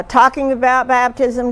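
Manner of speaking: normal talking voice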